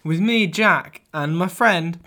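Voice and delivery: loud, high, fast radio host welcome; loud showman intro. Pretend. Jack hosting a mock intro for the Sharkman Show, hyped and loud. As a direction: sell the show like a hyped presenter. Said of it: "record a mock intro for the podcast".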